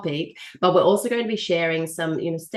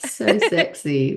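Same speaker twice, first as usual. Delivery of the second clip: mock sultry; much slower than usual. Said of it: "I've got the husky voice going today".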